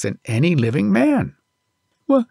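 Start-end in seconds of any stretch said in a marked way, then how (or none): 2.1-2.2 put-on frightened boy. A narrator's reading. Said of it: "said Little Toomai".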